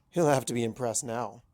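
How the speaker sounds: usual speaking voice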